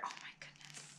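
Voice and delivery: soft, voiceless gasp of delight; awed whisper. Real, not acted. Opening a fan's old cards, whispering in awe. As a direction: breathe it out in a hushed whisper.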